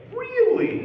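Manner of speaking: high, loud squeal of disbelief; mock amazement. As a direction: act out exaggerated surprise at the news. Mimics people amazed at his age.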